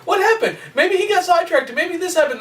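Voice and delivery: high-pitched mock bewilderment; agitated questioning. Villains wondering why the Hero hasn't come, agitated questioning. Acting out Ganon's puzzled waiting.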